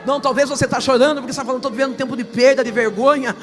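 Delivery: loud, high, fervent preaching, half sung; full preaching. Acted. Pastor preaching to the congregation, loud fervent preaching. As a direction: preach it full force to the church.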